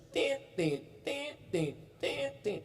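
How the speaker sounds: short broken beeps like a hospital monitor; beeping monitor. Shown as a demonstration. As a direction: imitate a coma monitor going tit tit. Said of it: "bunyinya belum panjang". (tiruan bunyi monitor yang putus-putus)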